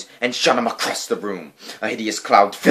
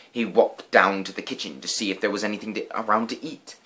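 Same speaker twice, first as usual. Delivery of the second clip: ordinary delivery, nothing marked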